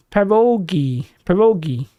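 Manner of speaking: "Perogi" with the O stressed. Shown as a demonstration. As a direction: lean on the O in "Perogi". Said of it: "emphasis on the O".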